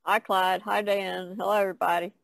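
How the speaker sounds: ordinary talk, nothing marked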